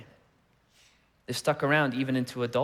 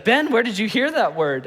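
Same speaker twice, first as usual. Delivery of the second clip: higher, lightly voiced alarmed mother; shocked mom; 7 semitones higher, 3 dB louder than usual. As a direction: imitate a startled mom asking sharply. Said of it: "and so she's like".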